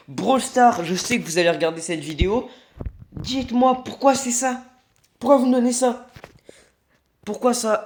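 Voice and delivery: high whiny complaint to Supercell; mock pleading complaint. Real, not acted. Complaining to the game makers. (il se plaint à Brawl Stars, aigu et geignard)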